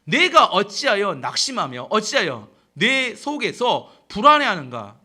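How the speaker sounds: loud, drawn-out reproach; acted-out stern questioning; self-rebuke. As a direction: demand an answer from your own soul. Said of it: "다윗이 책망하며 이야기하는 겁니다".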